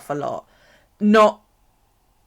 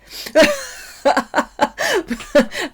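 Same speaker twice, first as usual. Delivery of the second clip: high rising burst of laughter; real laugh at herself; 12 semitones higher, 6 dB louder than usual. Real, not acted. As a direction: crack up high and loud. Laughing at her own joke.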